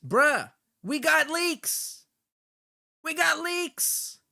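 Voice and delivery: high, loud, drawn-out cheer; cheering a jackpot find; excited whoop. Real, not acted. Streamer celebrating leaked logs to chat, excited shout.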